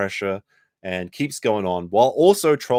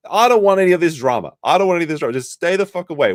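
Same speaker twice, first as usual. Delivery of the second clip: higher and louder, hammed-up panic; mock alarmed host; 8 semitones higher, 6 dB louder, faster than usual. Streamer imitating the host refusing drama, hammed-up loud panic. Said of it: "he's like".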